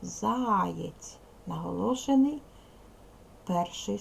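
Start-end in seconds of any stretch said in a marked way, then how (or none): 0.0-1.2 Заєць with the first vowel drawn out long; stressed word demo. Shown as a demonstration. Teacher showing pupils where the stress falls, drawn-out stress. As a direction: stretch the а in Заєць to show the stress. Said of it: "Ставимо наголос".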